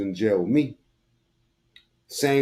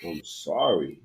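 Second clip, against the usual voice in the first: mock apology; 7 semitones lower, 5 dB quieter, slower than usual. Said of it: "mimicking the victim".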